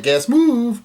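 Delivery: high, stretched-out mock cry; high drawn-out call. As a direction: draw the last word out high. Acting out a character's call.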